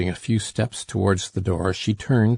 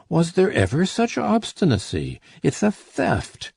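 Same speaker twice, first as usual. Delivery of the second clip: much higher outraged cry; angry cry; 9 semitones higher than usual. A narrator's reading. Miser outraged at his stubborn daughter, much higher cry. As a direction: accuse her of theft. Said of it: "cried Grandet".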